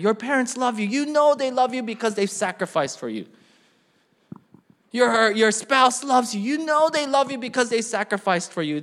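loud, driving pulpit voice; impassioned, projected sermon delivery; forceful preaching. Real, not acted. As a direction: press the point home to the congregation.

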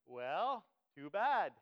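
loud, punchy mock-stern retort; big drawn-up dismissive reply; unmoved parent. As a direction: dismiss the complaint like an unmoved dad. Father answering joking son's complaint.

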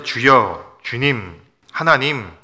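loud prayer cries of "주여", "주님", "하나님"; calling out to God. Shown as a demonstration. Pastor demonstrating a prayer cry to the congregation, loud. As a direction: call each name out like a desperate prayer. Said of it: "이렇게 부르짖죠".

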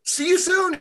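high, loud singsong farewell; calling out. A narrator's reading. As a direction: call it out high as if running off. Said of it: "he called out as he ran".

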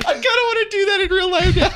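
high, squeaky laugh-talk; giggly and high-pitched; laughing while talking. Real, not acted. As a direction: barely get it out through laughter. Cracking up at the idea.

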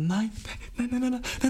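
rising, high panicked nein; flustered denial. Retold or imitated. He frantically takes back his question, high and flustered. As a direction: tumble out a panicked string of nein. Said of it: "ich war dann so".

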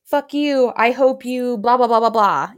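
hammed-up telling someone off; mock angry cursing. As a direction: toss out a mock curse, trailing into blahs. Example of cursing someone in anger.

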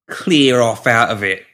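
half amused. A narrator's reading. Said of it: "he said, half amused".

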